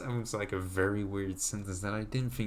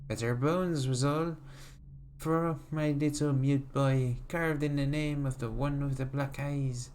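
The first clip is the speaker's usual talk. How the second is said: put-on creepy old granny, raised pitch. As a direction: croon like a sly, creepy old woman. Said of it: "How was my granny voice?".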